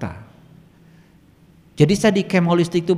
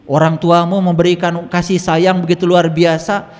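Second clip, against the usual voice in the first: re-enacted indignant telling-off, building higher and louder; scolding; 7 semitones higher, 7 dB louder than usual. Healer rebuking a patient who blames parents for stroke, scolding. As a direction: scold with mounting outrage. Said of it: "Saya omelin".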